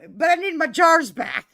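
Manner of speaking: hollered punchline. Retold or imitated. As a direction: holler Dad's demand, about to crack up. Retelling Dad's punchline, cracking up.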